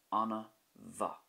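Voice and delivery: Anna bha with mh as a V, anna va. Shown as a demonstration. Teacher showing learners bha with a V sound. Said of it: "This is a V sound as well".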